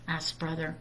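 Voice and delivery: plain reading voice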